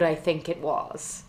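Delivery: ordinary talk, nothing marked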